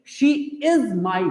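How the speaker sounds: slow explanation. Shown as a demonstration. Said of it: "अगले दो sentence को देख लो".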